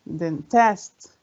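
"dentist" as den-TIST, rising in question; stress on second syllable. Shown as a demonstration. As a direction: hit TIST in "dentist" hard. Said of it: "بنقولها كده؟".

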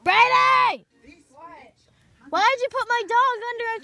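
yelling a brother's name; angry shout. Real, not acted. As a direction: call him out, annoyed. Sister calls out brother.

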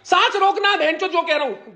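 angrily barking an order to hold his breath; angry command. Real, not acted. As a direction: snap the order up high at him.

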